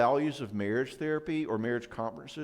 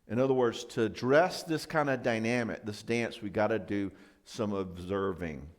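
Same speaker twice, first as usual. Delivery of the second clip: ordinary talk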